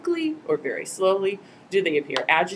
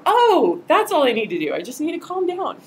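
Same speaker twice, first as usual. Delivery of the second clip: higher, louder mock-sarcastic retort; sarcastic reply; 7 semitones higher, 5 dB louder than usual. Upset participant mocks being told to calm down, higher sarcastic retort. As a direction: throw it back sarcastically. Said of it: "has never worked".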